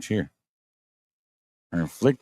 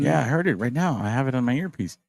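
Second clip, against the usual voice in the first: higher, playful imitating voice; high mock voice; 6 semitones higher than usual. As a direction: squeak it like the catcher. Voicing the catcher's reply.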